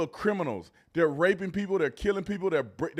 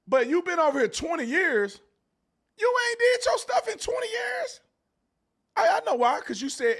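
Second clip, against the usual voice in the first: much higher, louder, yelling in outrage; angry shout; 6 dB louder than usual. Real, not acted. Ranting at immigrants who stayed twenty years, angry high shout. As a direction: blow up and shout it at them.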